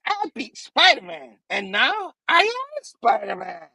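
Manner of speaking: high-pitched triumphant villain voice; hammed-up goblin gloating. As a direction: crow over a beaten rival, high and theatrical. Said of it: "And he's like".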